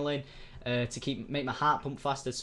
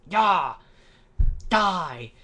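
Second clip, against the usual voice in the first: loud hammed-up attack shout, pitched higher; mock battle cry; 6 semitones higher, 9 dB louder than usual. Wasp as a villain shouting at the boy. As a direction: yell it like a cartoon villain striking.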